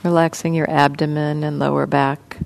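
soft, even voice with long pauses; guided meditation. Real, not acted. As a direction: gently guide listeners into relaxing, leaving space between phrases. Leads a guided body-scan meditation.

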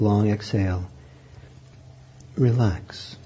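slow, soft meditation voice with long pauses; guided meditation. Acted. Teacher guiding students into breathing, soft and slow. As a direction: ease them into the breath, unhurried and gentle.

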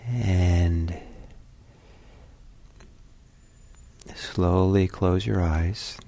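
measured meditation guidance with long pauses; calm, unhurried meditation teacher; guided meditation. Real, not acted. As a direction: ease listeners into stillness, leaving space between phrases.

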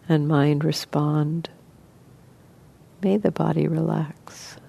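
soft, even voice with long pauses; guided meditation. Acted. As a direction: gently guide listeners into relaxing, leaving space between phrases.

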